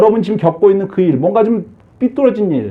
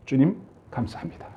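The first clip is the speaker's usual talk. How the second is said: soft thanks; 6 semitones lower, 13 dB quieter than usual. Shown as a demonstration. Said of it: "이 말을 해".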